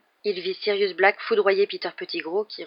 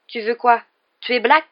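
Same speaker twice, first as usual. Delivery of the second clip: loud, higher, tense questioning; tense challenge; 5 semitones higher, 10 dB louder, slower than usual. A narrator's reading. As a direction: press the question, voice raised and tense. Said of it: "l'air tendu".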